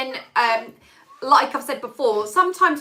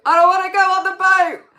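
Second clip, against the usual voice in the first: whiny loud protest; 7 semitones higher, 9 dB louder than usual. Retold or imitated. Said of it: "I was like".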